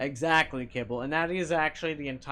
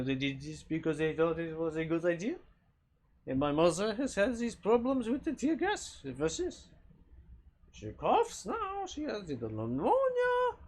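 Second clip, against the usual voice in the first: hammed-up French accent, pitched higher as it goes; a bad stage French accent, rising higher and higher; 6 semitones higher than usual. Streamer doing a bad French accent for a minute. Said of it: "I talk with the French accent".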